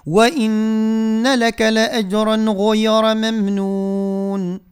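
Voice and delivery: slow, drawn-out tajwid recitation; Quran recitation. Shown as a demonstration. Teacher recites for the listeners, slow tajwid chant. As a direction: recite each word long and measured.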